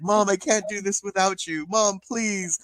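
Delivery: high mock-crying plea; imitated tearful pleading. Daughter begging her mom in the retold scene, mock tearful. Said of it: "and she's crying".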